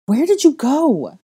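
loud high slow demand. A narrator's reading. Girl confronting the boy who vanished, loud demand. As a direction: hurl it at him. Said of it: "I demanded".

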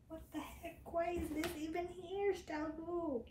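usual speaking voice

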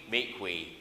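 ordinary talk

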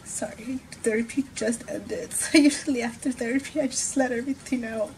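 teary, voice wobbling after therapy; tearful and worn out; crying. Real, not acted. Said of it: "I'm crying more than usual".

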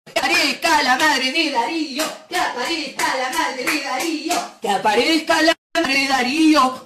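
sing-song pretend appeal; mock summoning call. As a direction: call out for a missing person. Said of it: "Ahí la llamamos".

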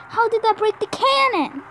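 loud, disbelieving rant; loud furious disbelief; angry yelling. Real, not acted. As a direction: yell at the game in frustration. Raging at the game result.

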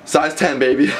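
high, gleeful line breaking into laughter; giddy celebration. Real, not acted. Celebrating the size ten to viewers, giddy laughing. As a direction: crow it, then burst out laughing.

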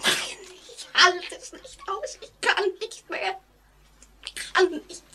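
falling apart in fear; high, broken voice near tears; on the verge of tears. Acted. Breaking down under the memory.

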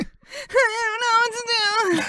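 slow, high, loud mock wail; not-ready voice. Pretending to be lost at the cue, not-ready voice. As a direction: wail helplessly in a put-on squeak. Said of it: "It's my not ready voice".

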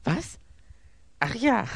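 high, loud exclamation into forced laugh; grim laugh. A narrator's reading. Robbed man covering up before his servant, grimly laughing. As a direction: catch on, then laugh bitterly. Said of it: "Er lachte verbissen auf".

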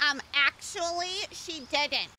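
nerdy know-it-all. Retold or imitated. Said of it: "they just double down and go".

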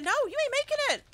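high, fast gleeful taunt; mocking a survivor who won't escape; rapid taunt. Real, not acted. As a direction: rattle it off at a fleeing player. Taunting fleeing victim.

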